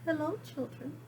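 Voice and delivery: sweet greeting. Acted. Miss Nelson returning to her class, soft and sweet. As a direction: greet them tenderly. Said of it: "in a sweet voice".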